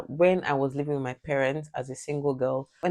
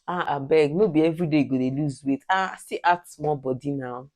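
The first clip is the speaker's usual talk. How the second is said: lightly voiced pushy aunty in Nigerian pidgin. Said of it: "African aunties will tell you".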